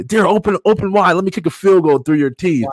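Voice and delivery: high mocking taunt; singsong bully taunt; mocking kids. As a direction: tease like schoolyard bullies. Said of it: "and they would say".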